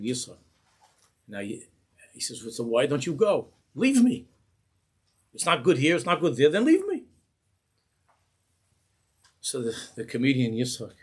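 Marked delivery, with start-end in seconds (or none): 2.4-7.0 much higher and louder, furious shout; angry scream. Retold or imitated. Rebbe screaming at Yitzchak to leave him, furious. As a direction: throw it at him, sending him away. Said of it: "then he starts to scream".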